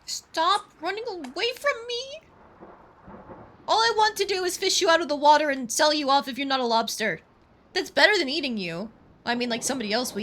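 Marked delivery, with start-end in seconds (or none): 0.0-2.2 much higher, slow exasperated plea; frustrated high cry. Real, not acted. Fish escaping, yelling at it. 3.7-8.9 louder, raised-pitch ranting at the fish; exasperated rant. Real, not acted. Scolding the fish.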